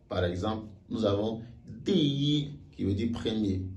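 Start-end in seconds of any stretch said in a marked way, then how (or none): 1.8-2.5 "di yi": falling "di", high level "yi"; first-tone yi. Shown as a demonstration. Teacher shows ordinal number, high level "yi" in "di yi". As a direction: drop "di", hold "yi" high and even. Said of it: "il se lit automatiquement yi".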